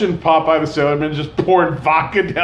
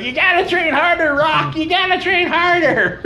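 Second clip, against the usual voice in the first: put-on much higher old trainer yelling; mock coach Mickey; 12 semitones higher than usual. Imagining Stallone's coach yelling, high mock trainer. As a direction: bark it like an old boxing coach.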